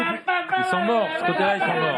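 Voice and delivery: high slow mock despair; mock dismay. Real, not acted. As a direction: wail that they're all dead. Host mocks silent audience. (désespoir moqueur, aigu et lent)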